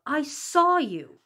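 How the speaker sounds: loud, slow, emphatic; loud accusation. A narrator's reading. Johnny confronting the teacher, loud slow accusation. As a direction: hammer each word at her slowly. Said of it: "Johnny burst out".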